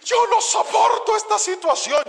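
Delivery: high, tearful wife, half-sobbing the line; imitating a crying wife. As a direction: voice the wife at the end of her rope.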